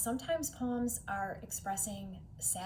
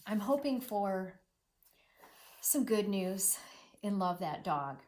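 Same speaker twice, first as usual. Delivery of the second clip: ordinary talk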